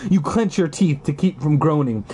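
ordinary talk, nothing marked

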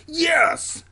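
high victorious yes; triumphant cheer. A narrator's reading. Zachy celebrating like Rocky, triumphant high cheer. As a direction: cheer with fists in the air.

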